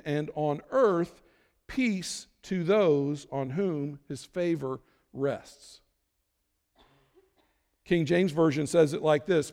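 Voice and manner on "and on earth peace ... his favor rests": on earth peace to those spoken slowly, each word weighed; slow it down and lean on every word; key phrase stressed. Shown as a demonstration. Pastor stressing the scripture phrase to his congregation, slow and weighty. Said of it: "and here's the phrase".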